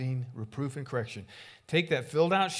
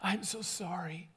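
alarmed apology; 7 semitones higher, slower than usual. Retold or imitated. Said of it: "you think".

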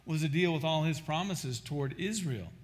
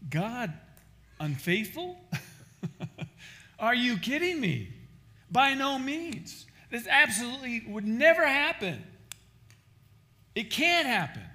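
incredulous exclamation; 8 semitones higher, 3 dB louder than usual. Acted. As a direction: ring out Paul's outraged disbelief. Acting out Paul's indignant answer.

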